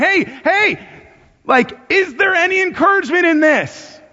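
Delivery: high, urgent mock shout; exhortation shout. Exhorting the church to find encouragement, high-pitched shout. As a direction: call it out high, like rousing a crowd. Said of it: "like a shouting".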